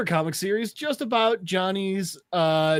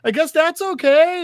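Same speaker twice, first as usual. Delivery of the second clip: high-pitched imitated captive; nervous placating; 9 semitones higher, 4 dB louder than usual. Captive approving lettuce for the maniac, high nervous placating. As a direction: reassure the madman, high and hesitant.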